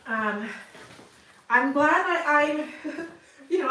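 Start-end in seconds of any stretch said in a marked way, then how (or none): 1.5-3.7 laughing through the words, slowed. Real, not acted. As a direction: giggle while thanking a kind viewer. Laughing while thanking viewer.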